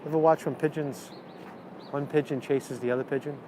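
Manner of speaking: ordinary talk, nothing marked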